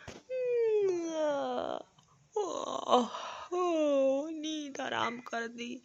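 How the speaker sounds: low, soft sleepy groans on waking; groggy waking. Acted. Girl woken by the shouting, groggy and half asleep. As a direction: drag yourself out of deep sleep.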